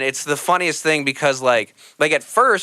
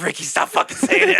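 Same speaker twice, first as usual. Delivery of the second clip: much higher, laughing plea; half-laughing call across the room; to a comic on stage, a high laughing shout from the back. Retold or imitated.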